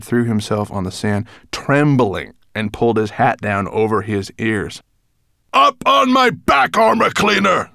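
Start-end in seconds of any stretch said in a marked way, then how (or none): 5.5-7.7 high, loud snapped order; angry snap. A narrator's reading. Nettlebrand ordering his dwarf aboard, snapped. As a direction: snap out the command. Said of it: "snapped Nettlebrand".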